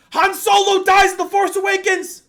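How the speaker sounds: high, loud spoiler shout; retold yell. Stranger yelling a spoiler to a packed theater, shrill loud yell. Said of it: "starts yelling".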